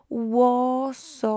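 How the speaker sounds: "Łososiu" drawn out slowly; slow model word. Shown as a demonstration. Teacher modelling the locative for students, slow. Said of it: "What's going to happen now".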